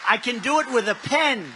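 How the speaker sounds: loud, high rally boast. Real, not acted. Candidate boasting to rally crowd, loud and high. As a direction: belt it out to the crowd.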